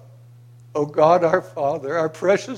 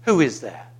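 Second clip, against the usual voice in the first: ordinary talk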